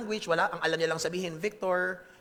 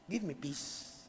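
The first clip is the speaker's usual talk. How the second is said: hushed, low, halting entreaty; quiet plea; 3 semitones lower, 10 dB quieter than usual. Acted. Creature begging its maker for peace, quiet plea. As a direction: plead softly, with long pauses.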